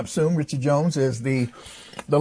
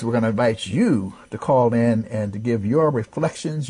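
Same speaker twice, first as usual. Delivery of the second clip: usual speaking voice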